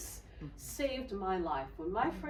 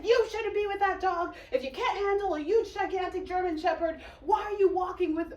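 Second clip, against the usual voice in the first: high, loud, fast acted tirade; furious rebuke; 10 semitones higher, 6 dB louder, faster than usual. Dog sitter berating the girl over the shepherd, furious. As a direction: rant rapidly in a raised, outraged voice.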